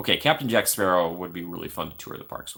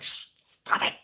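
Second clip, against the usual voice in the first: hushing. Retold or imitated. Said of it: "and you're like".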